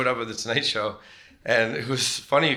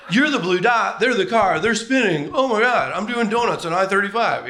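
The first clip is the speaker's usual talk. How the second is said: higher and louder excited rant; frantic rider spinning on the map; hammed-up escalating alarm; mock panic; 9 semitones higher, 6 dB louder than usual. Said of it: "then being like".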